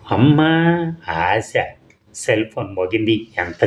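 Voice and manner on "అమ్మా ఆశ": mock exasperation. A narrator's reading. Narrator scoffs at his wish.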